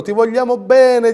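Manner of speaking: high, slow, swooning mock adulation; adoring Fantozzi crowd. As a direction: gush like the fawning crowd in Fantozzi. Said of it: "E gli urlano". (folla adorante alla Fantozzi, voce alta e lenta)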